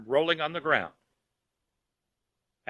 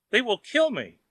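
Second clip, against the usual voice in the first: high wail, a bit louder; louder panicked wail; 9 semitones higher, 4 dB louder than usual. A narrator's reading. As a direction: cry it again even more desperate. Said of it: "wailed Wang".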